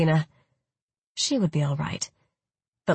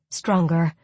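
usual speaking voice

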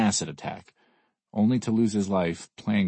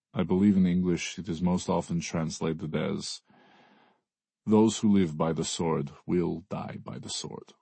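put-on Russian accent. A narrator's reading. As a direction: quote scripture gravely, Russian accent. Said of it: "he asks in a Russian accent".

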